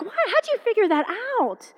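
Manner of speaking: high-pitched disbelief; astonished. Retold or imitated. Mother amazed at her daughter solving it, incredulous.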